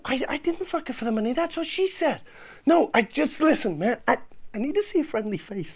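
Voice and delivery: ordinary delivery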